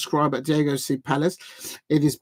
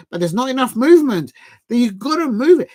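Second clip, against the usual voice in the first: louder, higher exasperated push; frustrated urging; 6 semitones higher, 5 dB louder than usual. Real, not acted. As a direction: demand the team move it. Frustrated pundit urging movement.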